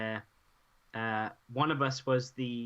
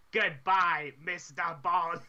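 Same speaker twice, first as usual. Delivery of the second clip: villain farewell; 4 semitones higher, much slower than usual. Retold or imitated. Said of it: "looked me dead in the eye and went".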